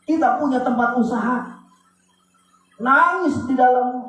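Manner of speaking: high, forceful preacher's cry; fiery preaching. Acted. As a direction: preach it out with full conviction. (khotbah berapi-api dengan suara tinggi)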